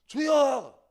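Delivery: 높고 크게 주님을 외침; 기도회에서 부르짖듯 크게; 부르짖는 기도. Acted. 부르짖어 기도하는 시늉. (과장된 통성기도 흉내)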